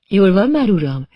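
mock-sweet question with head tilted; Miss Moss asking. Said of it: "kérdezi Miss Moss félrehajtva a fejét".

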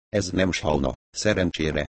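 ordinary delivery

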